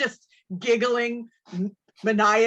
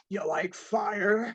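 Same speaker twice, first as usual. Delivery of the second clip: creepy villain; 4 dB quieter, slower than usual. Retold or imitated. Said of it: "it turns more into".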